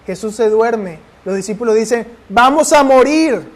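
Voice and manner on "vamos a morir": loud, high panicked cry that the boat is sinking. Acted. Disciples crying out to the sleeping Jesus in panic. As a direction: yell it like terrified men in a storm.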